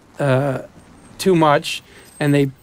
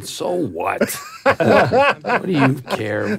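drawn-out, laughing brush-off; mock dismissive; 3 semitones higher, slower than usual. Actor answering offended Anglophiles, gleefully dismissive. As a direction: shrug off the complaint through a laugh. Mimics his own gleeful retort.

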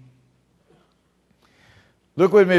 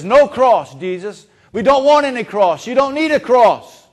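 higher, louder insistent protest; protesting Peter; 5 semitones higher, 7 dB louder than usual. Acted. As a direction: push back hard against the cross. Peter rebuking Jesus.

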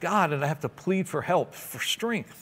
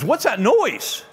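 startled punchline; 8 semitones higher, 5 dB louder, slower than usual. Retold or imitated. Punchline, hillbilly startled by saw.

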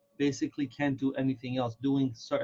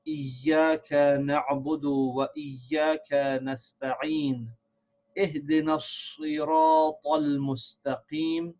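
measured, sustained Arabic recitation of Al-Fatiha; Quran recitation. Shown as a demonstration. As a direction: recite verse by verse, holding the long vowels. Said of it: "let's recite it from the beginning".

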